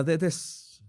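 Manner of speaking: normal talking voice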